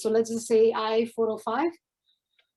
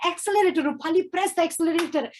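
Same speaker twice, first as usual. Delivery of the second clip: fast, higher, pushy repeated command; urgent instructions; 4 semitones higher, faster than usual. Retold or imitated. Friend teaching her to drive, urging fast and higher. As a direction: hurry her to hit the gas. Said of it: "So he's like".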